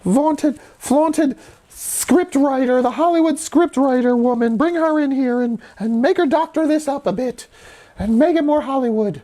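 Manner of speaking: high-pitched mock studio boss voice; studio executive. Studio exec demanding a script doctor, high mocking voice. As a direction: pitch it way up as a pushy executive parody. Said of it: "and be like".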